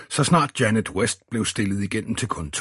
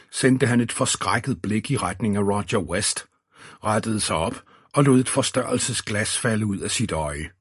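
plain reading voice

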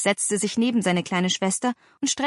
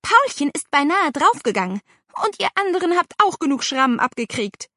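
high, raised reproach; angry scolding; 7 semitones higher, 4 dB louder than usual. A narrator's reading. Aunt reminding children of their injuries, scolding. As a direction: hammer home the danger.

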